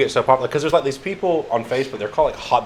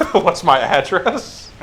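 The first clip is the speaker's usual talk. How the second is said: slow, laughing disbelief; 7 semitones higher, 3 dB louder, much slower than usual. Real, not acted. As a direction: laugh through the question. Laughs at joke question.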